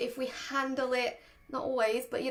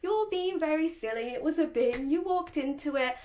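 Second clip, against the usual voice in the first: over-the-top singsong puppy talk; higher, playful kids TV presenter lilt; upbeat; 4 semitones higher than usual. Shown as a demonstration. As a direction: make light of it with cheerful mock scolding. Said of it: "this upbeat".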